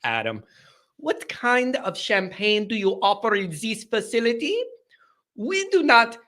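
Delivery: put-on lampoon a pampered rich fan in a made-up accent; hammed-up snobby made-up accent; mock posh accent. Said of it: "I don't know what accent that is".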